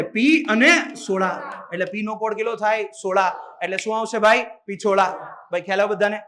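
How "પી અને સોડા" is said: slow, word by word; slow split. Shown as a demonstration. Teacher to students, slow mnemonic split. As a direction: spell out પી અને soda slowly for the class. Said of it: "આના પરથી યાદ રહે".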